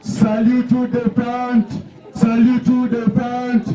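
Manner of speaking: drawn-out parade ground commands; drill commands. Acted. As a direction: call each order to the whole parade.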